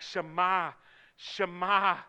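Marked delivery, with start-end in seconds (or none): none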